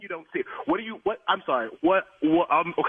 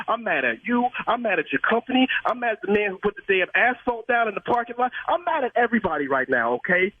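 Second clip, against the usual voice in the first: usual speaking voice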